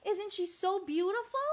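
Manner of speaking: ordinary delivery, nothing marked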